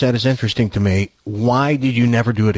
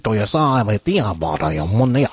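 mock Vietnamese; 5 semitones lower than usual. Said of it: "obviously this is not Vietnamese".

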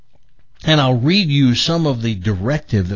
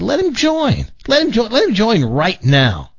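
emphatic urging; 10 semitones higher than usual. Retold or imitated. Host retelling his advice to a gym owner, high emphatic urging. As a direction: pitch leaping high on retold advice.

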